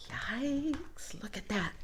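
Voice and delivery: breathless whisper to the listener; whispered aside. Real, not acted. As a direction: whisper it as if sharing a secret. Aside about picture.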